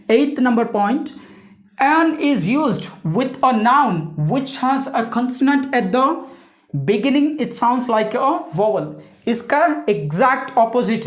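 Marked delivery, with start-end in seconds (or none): 1.8-8.9 very slow, deliberate rule reading; teacher reading the rule slowly so pupils understand; slow dictation. Shown as a demonstration. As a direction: spell the rule out slowly for the class. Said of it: "reads out the next rule".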